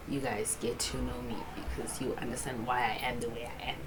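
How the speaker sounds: ordinary delivery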